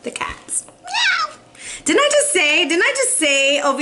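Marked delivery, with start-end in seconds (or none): 0.7-1.3 high, drawn-out meow; cat meow. Acted. Reader echoing the cat card, meowing. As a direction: mimic a cat's meow high up.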